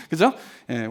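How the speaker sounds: normal talking voice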